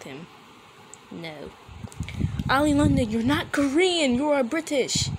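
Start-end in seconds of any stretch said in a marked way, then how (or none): 2.5-5.1 higher, louder exasperated yelling at the video. Real, not acted. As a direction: shout it at the screen in disbelief. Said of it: "I'm sorry for yelling".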